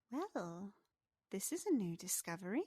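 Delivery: lilting little pixie voice; pixie character voice. Acted. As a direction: say it as a curious pixie. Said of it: "And she goes".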